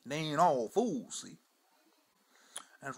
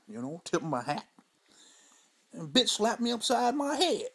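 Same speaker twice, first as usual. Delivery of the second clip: put-on old Mudbone; 3 semitones higher, 4 dB louder than usual. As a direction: spin the yarn like a weathered old man on the porch. Old storyteller character throughout.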